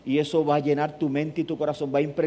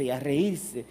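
usual speaking voice

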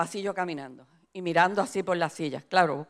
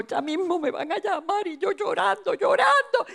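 mock tearful dread; 9 semitones higher than usual. Retells crying in dread before preaching.

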